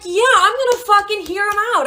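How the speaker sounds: high-pitched, hyped-up agreement. Retold or imitated. Client eagerly agreeing with therapist, hyped re-enactment. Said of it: "I'm hyped as fuck".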